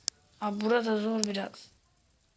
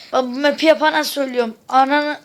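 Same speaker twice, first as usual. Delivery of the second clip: angry rant; 4 semitones higher, 12 dB louder than usual. Real, not acted. Cursing at the map maker.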